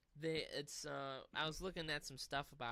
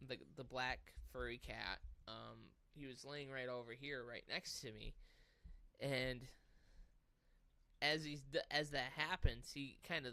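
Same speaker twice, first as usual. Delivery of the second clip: usual speaking voice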